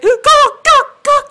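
high, loud laughing cry of go; giggly squealed go go go; mock urgent yell. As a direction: shout it like shooing off a bird while laughing. Mimics releasing the magic bird.

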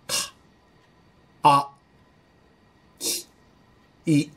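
か and き with the vowel mouth shaped first, then the sound; separated syllables か あ き い, vowel-first; Japanese-style ka ki. Shown as a demonstration. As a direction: set the vowel shape before the consonant. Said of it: "これが日本語のか、きです".

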